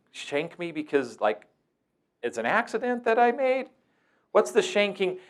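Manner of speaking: high, playful mock-innocent questioning; sarcastic teasing. Staff member teasing the kid about his threat, mock-innocent and high. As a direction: tease him with fake concern. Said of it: "staff thought that was pretty funny".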